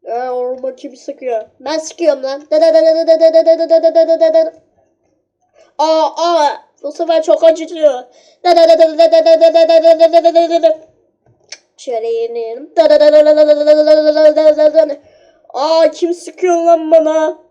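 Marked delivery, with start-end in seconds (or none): 2.5-4.5 rapid da da da gun noises, louder; mock gunfire. Kid firing at his friend with mouth gunfire. 8.4-10.8 rapid da da da gun noises, louder; mock gunfire. Kid firing at his friend with mouth gunfire. 12.8-15.0 rapid da da da gun noises, louder; mock gunfire. Kid firing at his friend with mouth gunfire.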